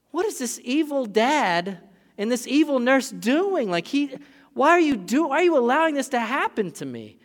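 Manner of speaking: high, loud, pleading cry; frightened child. Retold or imitated. Toddler crying at dad and nurse, high and pleading. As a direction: plead like a betrayed little boy.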